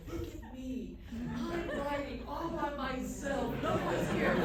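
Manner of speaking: playing the lonely martyr writer; hammed-up misery with laughs; mock self-pity. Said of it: "make myself as miserable as possible".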